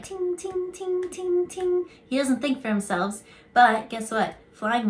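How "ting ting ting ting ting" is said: high bell-like ting sounds; high tinkling ting. Retold or imitated. Picturing the toy monkey's cymbals, high tinkly ting. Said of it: "I keep seeing, like".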